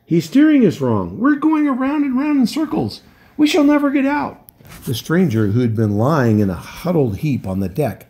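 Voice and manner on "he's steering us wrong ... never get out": panicked wail. Acted.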